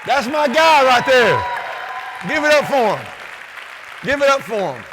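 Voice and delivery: loud, high hype for the crowd; loud cheer. Real, not acted. Speaker urging the audience to cheer his coach, loud. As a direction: whip up the applause.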